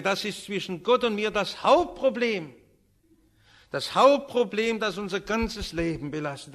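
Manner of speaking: loud, emphatic, weighty proclamation; fervent preaching. Real, not acted. Preacher thanking the Lord and confessing guilt, fervent. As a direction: drive each phrase home with force. Prayer, then preaching at full force.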